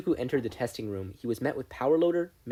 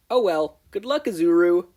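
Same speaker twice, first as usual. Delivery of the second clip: loud, higher careless farewell; breezy call; 6 semitones higher, 9 dB louder than usual. Acted. Mei calling good luck to Izuku, loud. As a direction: call after him carelessly.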